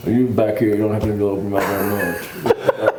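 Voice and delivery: Texan accent swallowed back in the throat; mumbled, throaty Texan accent. Shown as a demonstration. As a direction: barely open the mouth, Texan style. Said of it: "you just put it back in your throat".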